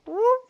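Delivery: sudden high loud whoop; high whoop sound. Real, not acted. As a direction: squeak it out like a quick move. Imitating hip movement sound.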